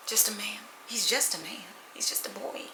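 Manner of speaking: whispered dismissive aside. Real, not acted. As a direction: whisper it like a private joke. Dismissive aside about the guy.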